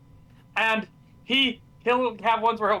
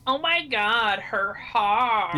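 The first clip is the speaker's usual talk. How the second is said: put-on Valley Girl accent; 3 semitones higher, much slower than usual. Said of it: "stereotypical Valley Girl thing".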